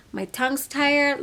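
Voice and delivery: ordinary talk, nothing marked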